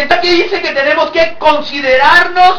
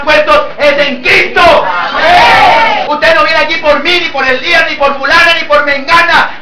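loud, raised preacher's exhortation; fervent preaching; 4 semitones higher, 5 dB louder than usual. Real, not acted. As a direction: build to a full-force praise shout. Preaches at full force. (predicación ferviente a voz en grito)

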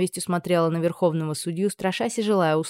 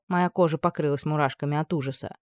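plain reading voice

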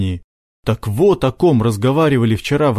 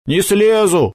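raised, defiant refusal; firm; 8 semitones higher, slower than usual. A narrator's reading. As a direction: dig in and refuse. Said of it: "твёрдо сказал Макар".

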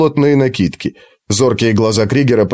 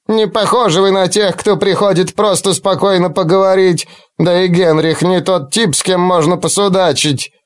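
put-on old woman; 5 semitones higher than usual. Old woman voiced by narrator.

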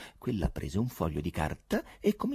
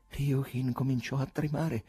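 plain reading voice

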